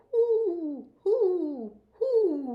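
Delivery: high, loud hooting owl. Acted. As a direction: call out like an owl. Voices the owl's call.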